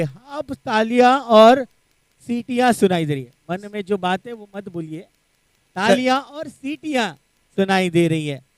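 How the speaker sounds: dropped to a near hush. Acted. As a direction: say it softly, as a teasing aside. Commentator hushes, mock whisper.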